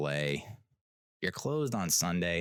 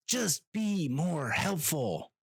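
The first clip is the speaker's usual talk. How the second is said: high, slow, drawn-out frustrated plea; worn-out begging for help; exasperated plea; 7 semitones higher, much slower than usual. Real, not acted. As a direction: plead slowly, stretching every word high.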